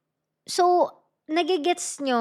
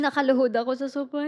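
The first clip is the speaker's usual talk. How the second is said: usual speaking voice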